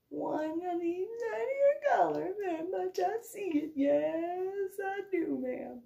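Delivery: high, slow, softening nervous reply; nervous backpedaling. Retold or imitated. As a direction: stammer an apology to the colonel. Flustered private answering colonel.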